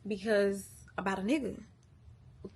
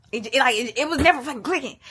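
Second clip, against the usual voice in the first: louder, higher burst of frustration; exasperated outburst; 3 semitones higher, 8 dB louder, faster than usual. Real, not acted. Venting to viewers about his endless excuse, exasperated. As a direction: hit it hard, fed up.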